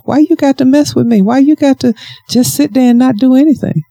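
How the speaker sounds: high, exasperated mock complaint; high-pitched, playful scolding; God questioning her. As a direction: pester them with a high-pitched why. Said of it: "He's like".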